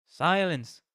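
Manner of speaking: loud, sharp command to the room; stern call for silence. Acted. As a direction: shut the class up at once. Teacher silences the class.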